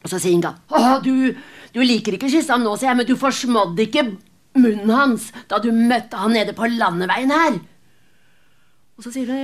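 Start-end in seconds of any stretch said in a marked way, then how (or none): 0.7-7.6 bitter accusation. Acted. Grieving mother accuses Kristin.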